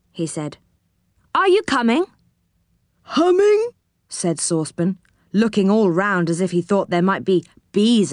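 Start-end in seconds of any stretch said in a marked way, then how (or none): 3.0-3.7 high questioning pipe; high comical bafflement; puzzled character voice. Acted. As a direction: echo the misheard word, pitched high. Saucepan man mishears.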